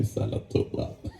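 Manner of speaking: slow, near-whispered close; hushed ending. Real, not acted. Speaker mourns the father who is gone, hushed slow whisper. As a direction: let the voice sink away slowly. Voice sinks at tribute's end.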